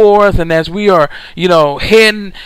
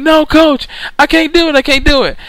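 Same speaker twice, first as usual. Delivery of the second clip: much higher, whiny plea; exhausted player pleading; 7 semitones higher than usual. Retold or imitated. Player tells the coach he won't make it, in a high, pained plea. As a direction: sound like you have nothing left.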